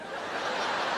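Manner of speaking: quick breathy put-upon sigh; short dramatic huff. Retold or imitated. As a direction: huff at the trash can. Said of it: "he makes a sound".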